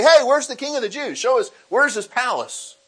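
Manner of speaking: high and loud, breezy tourist asking directions; cheerful magi asking. Retold or imitated. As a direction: hail a stranger on the street, all eager. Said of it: "they could say".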